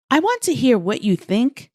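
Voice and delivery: ordinary talk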